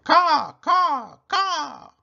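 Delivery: high, loud cawing crow; crow caws. Acted. Narrator voicing the fleeing mother crow, loud high cawing.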